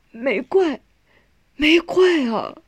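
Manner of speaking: high, drawn-out, grieving reassurance; emotional reassurance. A narrator's reading. Son forgiving his dying mother, anguished. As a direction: reassure through tears, voice lifted.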